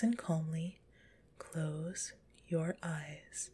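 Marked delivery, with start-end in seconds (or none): none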